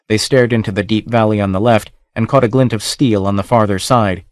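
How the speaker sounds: usual speaking voice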